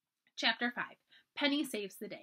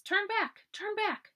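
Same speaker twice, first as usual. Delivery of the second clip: much higher, eerie warning cry; warning branches; 11 semitones higher, 3 dB louder, slower than usual. A narrator's reading. Wet branches warn Felicity away, eerie high cry. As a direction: voice the storm-tossed branches calling out. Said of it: "swinging warnings".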